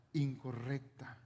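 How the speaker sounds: ordinary talk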